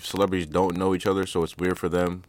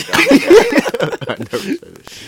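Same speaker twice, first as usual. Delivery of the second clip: much higher and louder, slow, laughing all through "I never said that"; laughing denial; 10 dB louder, much slower than usual. Real, not acted. Laughing denial after tease.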